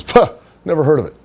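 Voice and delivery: high, quick, dismissive bro voice; Johnny Canine brushing off. Retold or imitated. Johnny dismissing Henry's WCW, high scoffing bro voice. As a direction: scoff it off fast in the high meathead voice. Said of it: "that's how he would talk".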